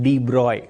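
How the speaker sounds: de Broglie said "dee broy", silent g; correct pronunciation. Shown as a demonstration. Teacher giving students the name, de Broglie pronounced "dee broy". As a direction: end de Broglie on "broy", no "glee". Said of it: "the pronunciation is de Broglie".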